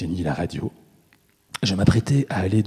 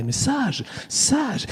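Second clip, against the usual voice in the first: put-on much higher, well-mannered child-like lilt; sweet little voice; much slower than usual. A narrator's reading. Abductor describing the boy's manners, sweet little voice. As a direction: lift it into a nice little voice. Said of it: "avec une gentille petite voix".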